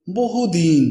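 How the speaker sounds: ordinary delivery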